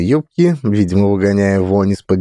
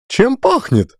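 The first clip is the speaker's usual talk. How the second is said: high shrill cry; shrill exclamation; slower than usual. A narrator's reading. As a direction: squeal the question. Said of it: "визгливо воскликнула Фёдоровна".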